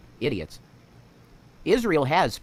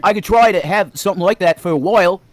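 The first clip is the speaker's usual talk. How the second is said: loud put-on British accent, drifting Australian; attempted British accent; 4 semitones higher, 8 dB louder than usual. Shown as a demonstration. Showing a friend an accent attempt, wobbly British. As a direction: have a go at a British accent, loudly. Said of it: "like, an Aussie thing or something".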